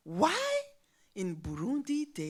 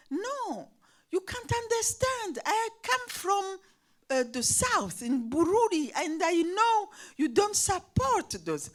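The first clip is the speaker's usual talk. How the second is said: high, emphatic "You can't understand."; insistent refusal; 8 semitones higher than usual. Retold or imitated. Pupil refusing her teacher's words, high and emphatic. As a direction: refuse hotly, pitched up high.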